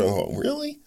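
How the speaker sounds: high, feigned disbelief; mock naive voter. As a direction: play a gullible voter, wide-eyed and high. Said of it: "people conjure".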